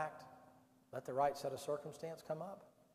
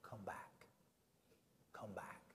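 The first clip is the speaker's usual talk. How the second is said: soft breathy whisper, drawn low; Holy Spirit whisper; 7 semitones lower, 14 dB quieter, slower than usual. Retold or imitated. The Holy Spirit whispering to the straying hearer, soft and low. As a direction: whisper it like a gentle inner call. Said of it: "the Holy Spirit is telling you".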